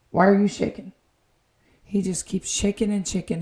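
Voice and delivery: ordinary talk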